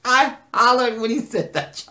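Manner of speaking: loud, high, between fits of laughter; tickled outburst. Real, not acted. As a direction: blurt it out amid big laughs. Laughing hard retelling own reaction.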